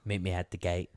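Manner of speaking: soft, low mock British rhyme; mock British accent. Mimicking British rap for co-host, mock British accent. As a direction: parody a British rapper's rhyme. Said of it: "like British rap".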